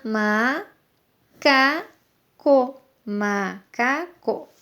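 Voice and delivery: "macaco" broken into ma, ca, co with pauses; syllable by syllable. Shown as a demonstration. Teacher splitting the word macaco, slow separated syllables. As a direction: say "macaco" slowly, each syllable apart. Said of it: "É tudo sílaba".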